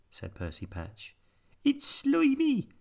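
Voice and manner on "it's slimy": very high, slow disgusted squeak; squeaky pirate voice. A narrator's reading. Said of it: "said Peg Polkadot".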